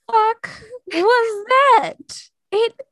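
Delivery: high, loud what-the-hell; as if hail just hit; shocked disbelief. Retold or imitated. Reacting to sudden hail.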